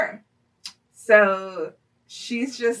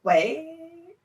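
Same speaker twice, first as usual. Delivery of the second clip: stunned realization; 8 semitones higher than usual. Retold or imitated. Realizing she was just hit on.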